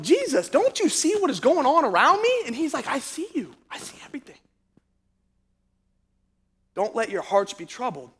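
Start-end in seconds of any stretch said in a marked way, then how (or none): 0.0-2.4 high, swooping incredulous plea; distressed pleading. Retold or imitated. Disciple pleading with Jesus amid trouble, retold distress. 2.9-8.1 hushed, tender answer, trailing into near-whisper; calm reassurance. Retold or imitated. Jesus reassuring a troubled disciple, calm and hushed.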